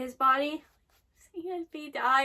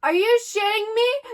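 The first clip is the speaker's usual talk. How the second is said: loud, higher, appalled shout; shocked outcry; 5 semitones higher, 6 dB louder than usual. Real, not acted. Viewer appalled by what just happened, shocked outcry. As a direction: cry out in real disbelief. Upset reaction to the show.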